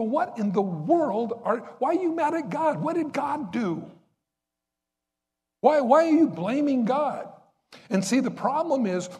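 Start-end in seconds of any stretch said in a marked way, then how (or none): 0.0-7.3 much higher, astonished disbelief; incredulous asking. Retold or imitated. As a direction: act out baffled outrage at someone blaming God. Preacher voicing incredulous question.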